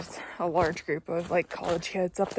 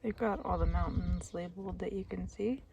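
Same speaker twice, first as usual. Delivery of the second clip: whispering; 10 dB quieter than usual. Real, not acted. Quiet at the summit near others.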